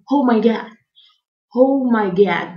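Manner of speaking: replayed appalled exclamation; acted shock. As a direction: relive the shock, stretching the words. Reliving shock of the rough exam.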